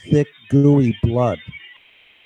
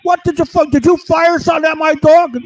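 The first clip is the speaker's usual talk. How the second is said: much higher, louder accusing voice; voicing the other guy; 14 semitones higher, 5 dB louder than usual. Second voice accusing his partner, high and loud. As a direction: squeal the accusation in a high pitch. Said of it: "The other one goes".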